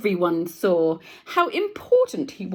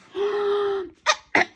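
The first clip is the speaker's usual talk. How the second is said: startled high gasp; 13 semitones higher, 3 dB louder than usual. Acted. Nose to nose with lion.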